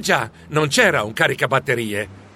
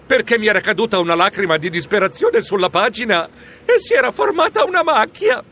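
plain reading voice

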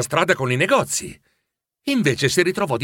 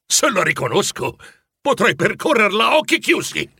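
startled excitement; 7 semitones higher than usual. A narrator's reading. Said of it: "sussultò".

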